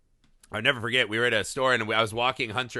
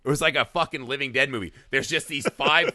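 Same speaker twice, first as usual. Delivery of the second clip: ordinary delivery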